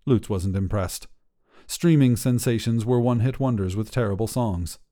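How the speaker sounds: plain reading voice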